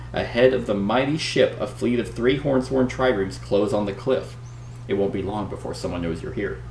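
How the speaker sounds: dramatic read-aloud narration; story narration. Acted. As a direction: read it like an adventure gamebook. Reads the gamebook story aloud.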